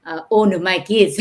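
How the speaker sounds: usual speaking voice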